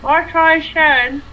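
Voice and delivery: talking around a pen in mouth; words muffled by a pen held in the mouth. Real, not acted. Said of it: "put a pen in my mouth".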